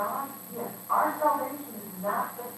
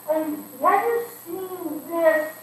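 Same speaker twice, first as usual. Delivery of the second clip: slow leading question; 7 semitones higher, 6 dB louder, much slower than usual. Acted.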